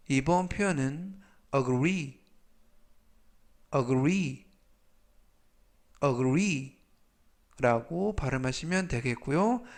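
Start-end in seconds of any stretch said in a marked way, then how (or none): 3.7-6.8 a-GREE, punch the second part; stress on the end. Shown as a demonstration. Teacher modeling agree with end stress for learners. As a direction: agree with weight on the last syllable, gree. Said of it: "강세는 뒷부분에 있는 알파벳 E에".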